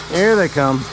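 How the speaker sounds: ordinary talk, nothing marked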